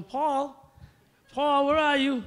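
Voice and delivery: mother calling. Retold or imitated. Mother calling her son down after the game, high and loud. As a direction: call up the stairs like mom. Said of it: "I hear my mother going".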